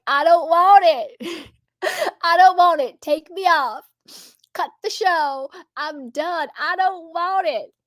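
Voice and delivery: high, drawn-out wailed imitation of a tearful man; mock crying. Imitating a crying talk-show guest from a meme, mock tearful wail. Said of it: "he's crying. And he's like".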